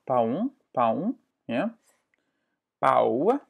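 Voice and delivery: ordinary delivery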